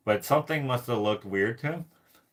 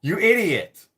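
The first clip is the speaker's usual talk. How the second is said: higher, louder exasperated yell; mock inner scream; 6 semitones higher, 6 dB louder than usual. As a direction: yell it the way you screamed in your head. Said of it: "screaming at him".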